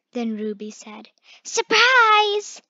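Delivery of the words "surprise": high, loud cheerful shout; bright high announcement call; excited surprise. Acted. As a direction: burst out with the treat news. Ruby announces a treat.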